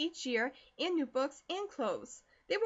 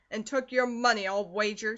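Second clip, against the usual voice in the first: loud, angry retort; 7 dB louder than usual. A narrator's reading. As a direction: snap it out in anger. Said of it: "said Sinton angrily".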